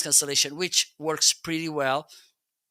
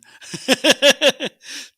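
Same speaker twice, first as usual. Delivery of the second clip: high laugh burst; 12 semitones higher, 10 dB louder than usual. Real, not acted. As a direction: giggle loudly. Laughs mid sentence.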